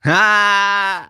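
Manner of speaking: high, loud yelp of surprise; startled exclamation; surprised shout. Real, not acted. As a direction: cry out in mock dismay. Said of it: "damn, I just finished mine".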